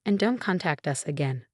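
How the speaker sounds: plain reading voice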